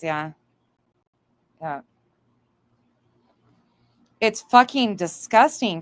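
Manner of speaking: ordinary talk, nothing marked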